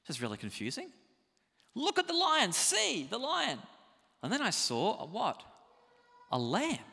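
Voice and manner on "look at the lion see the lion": excited urging. Retold or imitated. Elder urging John again.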